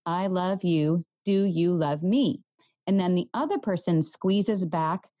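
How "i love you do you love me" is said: slow, evenly spaced words; one word per squeeze; gentle counting rhythm; slow squeeze pattern. Shown as a demonstration. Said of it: "you follow the pattern".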